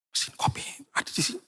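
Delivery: hushed, fast whisper of disbelief; breathy stage whisper of surprise; whispered aside. Retold or imitated. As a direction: whisper it like a secret discovery. Retelling seeing the coffee machine.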